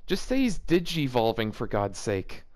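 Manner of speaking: ordinary delivery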